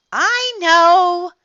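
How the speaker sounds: high, loud, drawn-out protest; crying out. A narrator's reading. Froggy answering dad's warning, crying out. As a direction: yell it back, stretched and high. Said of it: "cried Froggy".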